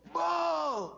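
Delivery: sudden loud high shout to startle; booming mask-wearer's jump-scare shout; scary boo. Acted. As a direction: jump out and yell boo at them. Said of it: "the person goes".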